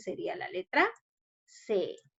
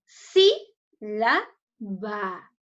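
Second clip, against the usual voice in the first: split into syllables; 4 semitones lower, 3 dB louder, much slower than usual. Shown as a demonstration. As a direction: sound out "Sí, la, va" with stress on the first.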